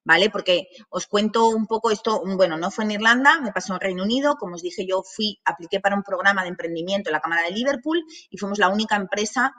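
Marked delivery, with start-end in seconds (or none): none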